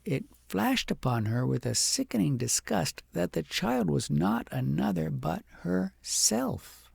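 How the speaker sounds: ordinary delivery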